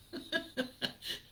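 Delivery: high airy burst of laughter; helpless giggle at own joke; high breathy laugh. Real, not acted.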